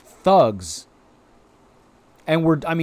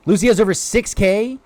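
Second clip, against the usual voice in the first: usual speaking voice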